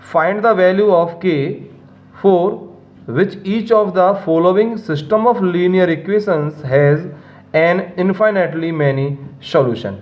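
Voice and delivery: slow, deliberate reading of the question; slow careful reading. Shown as a demonstration. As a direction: read the question out word by word, slowly. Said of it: "question को ध्यान से read करेंगे". (धीरे-धीरे ध्यान से पढ़ना)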